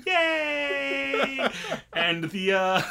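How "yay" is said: put-on very high squeaky kid yay; cutesy high cheer; tiny cheer. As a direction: cheer like a small child. Imitating cheering platelets.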